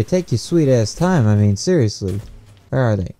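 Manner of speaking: normal talking voice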